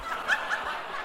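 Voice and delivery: high, airless burst of laughter; a real disbelieving laugh; a soundless high giggle; breathy laugh. Real, not acted. Laughing at the crow's arrival.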